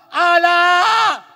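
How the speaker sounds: high, very loud sustained cries; long, desperate mid-fall shouts; falling scream. Acted. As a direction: keep screaming as you plummet. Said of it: "Sumisigaw pa rin siya".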